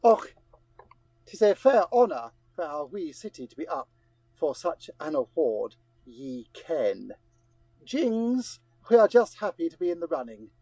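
put-on broad comic Scottish accent; hammed-up Scots brogue. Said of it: "a few words from the City of Dundee".

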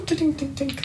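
high plinking "tink, tink" noise. Retold or imitated. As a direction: mimic a pebble bouncing off shell. GM imitates stone bouncing.

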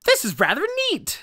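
very high-pitched mock amazement; imitated reaction tone. Friend parodies the streamer's win reaction, very high squeal. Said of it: "The tone is".

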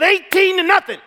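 put-on high, loud, bright-eyed boy answering; eager kid. Kid telling stranger the score, loud cheerful boy voice. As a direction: announce the score like it's nothing.

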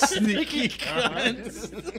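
drawn-out echo through laughter; laughing mimicry. Echoing a friend's Aussie insult, laughing mimicry. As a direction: slowly repeat it while cracking up.

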